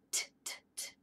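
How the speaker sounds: short unvoiced T clicks; crisp T taps. Shown as a demonstration. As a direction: tap out "T" like a tooth. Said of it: "tap his tooth like this".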